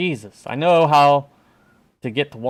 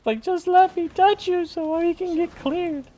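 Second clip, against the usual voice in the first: very high, monotone whine; high-pitched pleading. Real, not acted. Gamer begging teammate to be touched, high whine. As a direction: plead on one high, held note.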